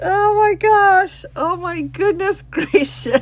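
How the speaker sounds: high and loud, slowly, through real laughter; a real giggle breaking through the words; laughing. Real, not acted. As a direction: gasp it out while still cracking up. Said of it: "Oh, I shouldn't laugh".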